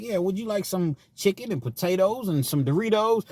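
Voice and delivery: lightly posh, high mock politeness; mock a fancy dinner host; a hint of a prim waiter; mock polite offer. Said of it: "They not gonna be like".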